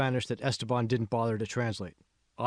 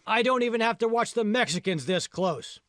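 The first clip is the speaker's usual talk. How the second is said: higher, scolding shout at the slackers; 11 semitones higher, 3 dB louder than usual. A narrator's reading. Supervisor to the two sneaking teens, shouting. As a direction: yell it across the field at the teenagers. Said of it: "he shouted".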